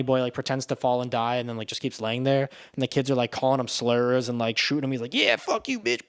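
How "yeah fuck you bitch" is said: put-on high sneering kid voice; raised bratty kid taunt; jeering kid. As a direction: jeer at him like a cocky boy. Kids shooting bunny boy.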